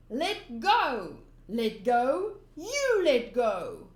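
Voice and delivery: loud, last line slow and dragged; tug-of-war yelling. Acted. Fighting over the treasure, loud yells. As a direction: yell while pulling hard.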